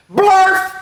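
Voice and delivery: high, loud yelp; nonsense word blurted. A narrator's reading. Reader forced to say nonsense, loud silly outburst.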